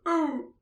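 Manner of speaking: high strangled grunt of pain. Retold or imitated. Kid grunts when stomped on, high and strained. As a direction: squeeze out a high pained oh. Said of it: "the kid grunts in pain".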